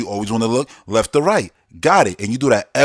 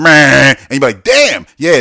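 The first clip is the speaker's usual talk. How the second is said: loud reaction; 9 dB louder than usual. Retold or imitated. Retold friends' reaction.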